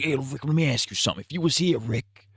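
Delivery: put-on Shane voice. Shane confronting Rick over what he'd do, put-on accent.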